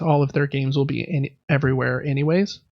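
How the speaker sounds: ordinary talk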